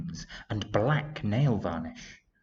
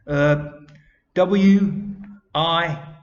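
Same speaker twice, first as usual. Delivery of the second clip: high, loud, halting attempt at spelling; stammering; 8 semitones higher, 9 dB louder, much slower than usual. A narrator's reading. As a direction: grope for each letter with pauses. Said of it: "he stammered".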